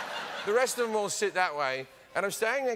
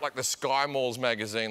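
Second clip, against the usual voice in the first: ordinary delivery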